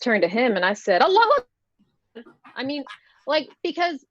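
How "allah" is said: high, loud exclamation; mock-menacing shout; sudden cry. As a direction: yell it out to startle, pitched way up. Startles a heckler with a cry.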